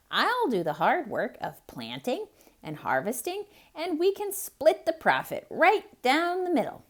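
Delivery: high, loud, eager hare voice; a clever hare rousing lazy Bear; Hare calling. Acted. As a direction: call the sleeping neighbor awake, bright and pushy. Hare pitches his deal.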